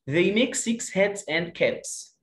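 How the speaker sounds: put-on slow, clear reading for children; slow teacher read-aloud; slow model reading. Shown as a demonstration. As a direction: read it out slowly, word by word.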